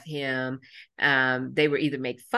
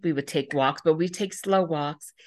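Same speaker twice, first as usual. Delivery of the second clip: normal talking voice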